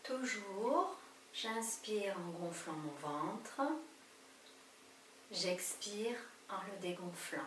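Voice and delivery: slow, soft breathing guidance; calm them down breath by breath; guided meditation. Acted.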